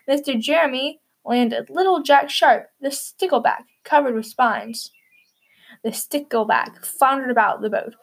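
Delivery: plain reading voice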